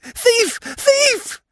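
hysterical, very high screaming; absolute yells. A narrator's reading. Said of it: "She uttered absolute yells".

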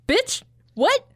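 high, shocked outburst; incredulous shriek. Retold or imitated. Heroine retold reacting to the wizard, high shocked cry. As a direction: blurt it in disbelief. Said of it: "she's like".

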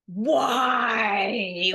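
a loud, anguished cry of why; frustrated cry. Retold or imitated. Retelling her breakdown to the host, frustrated yelled cry. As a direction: wail it like the bubble just burst. Bubble bursts, wants to flip table.

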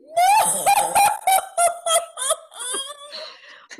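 big high shouted laugh; loud high laugh. Real, not acted. Narrator bursting into a loud high laugh.

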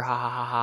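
mock laughing it off; spelled-out, put-on ha-ha laugh; fake laugh. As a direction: laugh falsely, as a flimsy excuse. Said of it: "Oh, haha".

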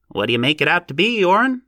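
high nasal questioning voice. A narrator's reading. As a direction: ask it through the nose. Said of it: "asked the naval voice".

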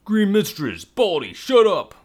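loud high scream, slowed. Acted. Navigator screaming at both to shut up. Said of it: "the navigator screamed".

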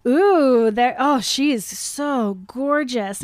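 loud ooh easing into slow gush; awestruck gushing. Acted. Best friend peeking through the window, gushing. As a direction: swoon over her, stretching the words. Acting Kevin spotting the woman in window.

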